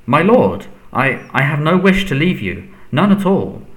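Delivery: frightened servant protesting; anxious denial. A narrator's reading. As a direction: plead your loyalty, rattled. Servant denies wanting to desert master.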